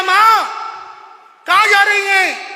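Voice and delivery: put-on high, loud child's voice; child calling out. Son asking his dressed-up mother, high and loud. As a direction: call to mother like a small boy.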